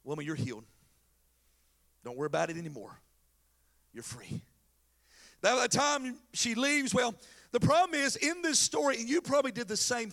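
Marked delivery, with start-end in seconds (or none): 0.0-4.4 low, soft, tender reassurance; gentle. Acted. Jesus gently reassures the healed woman. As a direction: speak it softly and low, letting each phrase land.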